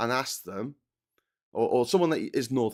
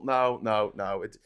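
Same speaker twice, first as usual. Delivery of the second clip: usual speaking voice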